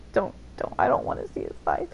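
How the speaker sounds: whispered, squeamish don't. Real, not acted. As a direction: hush it out in mock horror. Shies away from outfit.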